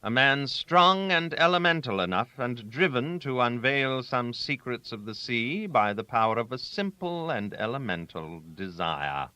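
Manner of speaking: ordinary delivery, nothing marked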